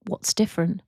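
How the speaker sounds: calm, even guided meditation voice with long pauses; guided visualization. Acted. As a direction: ease them into imagining, leaving space after each prompt.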